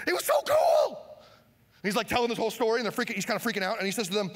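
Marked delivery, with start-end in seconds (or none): 0.1-1.1 a high, loud, gushing cry; hammed-up excited shout. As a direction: play it like a thrilled kid telling friends. Peter gushing about the miracle.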